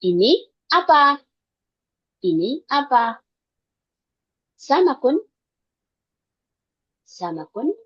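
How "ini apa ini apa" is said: louder startled "Ini apa?" repeated; surprised. Shown as a demonstration. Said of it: "seolah-olah gitu".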